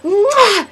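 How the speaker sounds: loud, high-pitched "mwah" smooch; hammed-up repeat kiss sound; exaggerated kiss noise. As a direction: burst out with a big smacking kiss. Imitates second big kiss.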